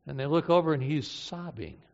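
normal talking voice

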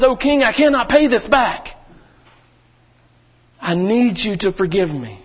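begging slave. Acted. Pastor voicing the slave begging the king, pleading. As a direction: beg the king for mercy. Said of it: "he falls down and he begs".

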